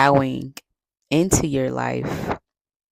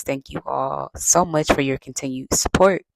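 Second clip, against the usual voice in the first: usual speaking voice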